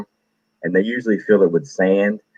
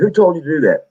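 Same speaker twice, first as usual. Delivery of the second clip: much higher barked question; drill sergeant; 9 semitones higher than usual. Retold or imitated. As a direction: grill the recruit like a drill instructor.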